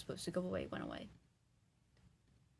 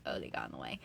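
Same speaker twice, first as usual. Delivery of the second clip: whispered aside; 7 dB quieter, faster than usual. Retold or imitated. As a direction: whisper it like a casual excuse. Voicing the killer's excuse.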